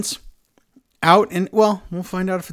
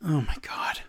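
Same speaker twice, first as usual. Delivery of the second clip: whispered, exasperated aside; hushed groan of dismay; 2 semitones higher, 8 dB quieter than usual. Real, not acted. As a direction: breathe it out in disbelief.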